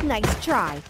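ordinary talk

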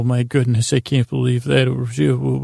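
ordinary talk, nothing marked

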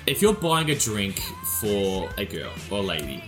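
ordinary talk, nothing marked

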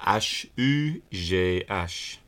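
"H U G H" spelt in French, slowly; French letter names. Shown as a demonstration. Host spelling his name in French, slow French letter names. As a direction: say "H U G H" as ash, ü, zhay, ash. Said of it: "spell your name in French".

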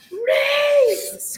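long, high, loud holler; drawn-out high-pitched yell; screaming. Retold or imitated. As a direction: scream a name from the balcony.

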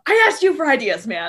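high, loud protest through a laugh; mock exasperation. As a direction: throw it back, comically fed up. Acted exasperated retort to partner.

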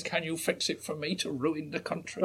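Jimmy Savile impression. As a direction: coo the question as Savile would. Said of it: "really good impression of Jimmy Savile".

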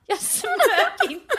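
high, slow quote dissolving into laughter; laughing denial. Retold or imitated. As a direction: imitate his protest while cracking up. Acts his denial while breaking into laughter.

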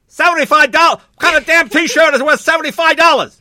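outraged scream. Retold or imitated. Dad screaming at store clerk over the T-shirt price, outraged yell. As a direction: bellow in disbelief at the price. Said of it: "just screaming at the guy".

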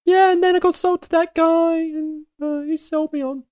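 high, chirpy little creature voice; squeaky fey creature. Acted. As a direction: play a sweet tiny fey critter. Turnip creature speaking.

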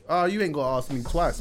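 normal talking voice

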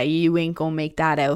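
ordinary talk, nothing marked

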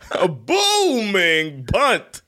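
high, drawn-out punt call; milk every syllable like an overexcited announcer; Gus Johnson. Retold or imitated. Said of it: "Gus Johnson with the".

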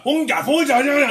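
high, loud, slow fake German; mock German. Player trying German on video, loud mock German. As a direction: call out in hammed-up German. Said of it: "he tried to speak German".

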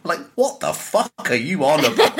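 what are you on about, exasperated; incredulous outburst. Real, not acted. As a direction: demand an answer in mock outrage.

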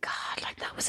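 breathy dreamy whisper. Retold or imitated. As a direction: whisper it like a fond secret. Retold wistful inner thought.

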